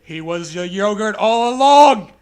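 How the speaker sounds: loud, hammed-up reveal; mock dramatic shout. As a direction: shout the twist like a horror trailer.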